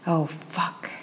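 soft, under-the-breath curse. A narrator's reading. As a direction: breathe it out quietly. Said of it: "she breathed".

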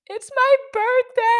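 high, loud mock-hysterical wail. Crash victim to bystander asking her age, hysterical wail. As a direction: imitate your own loud, high-pitched bawling. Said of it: "I'm just hysterically crying".

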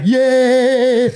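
put-on high, kid-like happy yeah; mock the husband's joy like a little boy; childlike yeah. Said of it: "like a little kid".

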